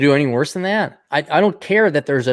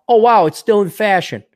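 mock wow; 5 semitones higher, 6 dB louder than usual. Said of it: "mock amazement".

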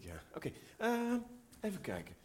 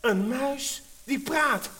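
amazed; 6 semitones higher, 10 dB louder, much slower than usual. Acted. Girl marvels at talking mouse.

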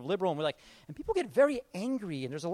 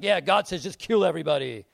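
mock caricature voice; 2 semitones lower, 6 dB louder than usual. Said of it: "Old Testament's like".

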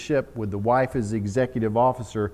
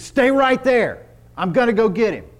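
firm rescuer order; 10 semitones higher, 8 dB louder than usual. Acted. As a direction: order her to wait. Rescuer ordering woman to wait.

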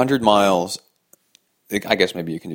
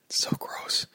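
whispered disgust; 10 dB quieter than usual. Real, not acted.

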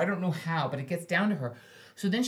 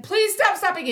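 higher, louder pleading call; asking the leader to stop stopping; repeated plea; 11 semitones higher, 5 dB louder than usual. Retold or imitated. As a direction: call it out again, begging. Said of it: "'cause I said".

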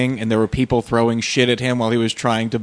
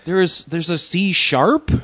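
mock baffled novice; 7 semitones higher, slower than usual. Joining the clueless-novice bit.

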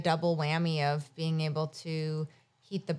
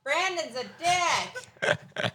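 yelling across the room; 10 semitones higher, much slower than usual. Real, not acted. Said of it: "that's why I yelled across the room".

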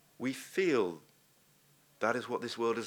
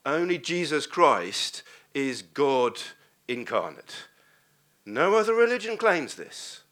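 louder, driving to the climax; fervent preaching; 3 semitones higher, 6 dB louder than usual. Acted. Preacher proclaiming God's love to the church, loud and fervent. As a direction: preach it home with conviction.

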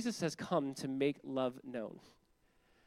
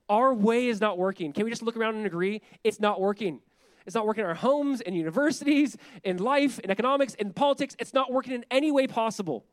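louder, higher, building to a preached climax; preaching at full force; 5 semitones higher, 6 dB louder than usual. Real, not acted. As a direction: hammer it home from the pulpit.

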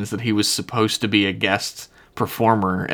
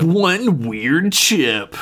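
slow, drawn-out mock trailer tagline, pitched up; eighties movie trailer; 6 semitones higher, much slower than usual. Riffing on the emotion chip as an eighties movie, mock trailer voice. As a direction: stretch each word like a movie tagline. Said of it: "That sounds like an eighties movie".

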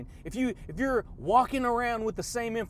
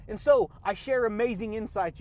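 ordinary talk, nothing marked

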